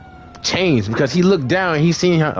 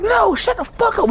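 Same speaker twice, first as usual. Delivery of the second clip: mock yell. Fighters shout at the girlfriend.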